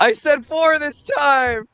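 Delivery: high, slow whiny scold at the dice; mock scolding. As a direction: drag it out like a whining child.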